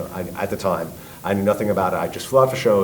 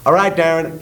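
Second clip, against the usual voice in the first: high-pitched calming attempt; retold urgent placating, pitched up; 7 semitones higher, 4 dB louder than usual. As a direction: imitate a bandmate trying to head him off. Drummer trying to calm him, retold.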